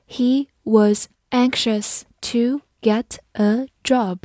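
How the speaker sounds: slow, deliberate teaching read; slow classroom model sentence. Shown as a demonstration. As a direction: stretch each word for learners.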